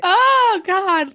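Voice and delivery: emotional outburst. Real, not acted. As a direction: gasp it out, overcome. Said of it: "I'm getting emotional".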